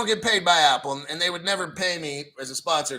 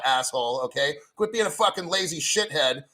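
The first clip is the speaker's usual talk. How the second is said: ordinary talk, nothing marked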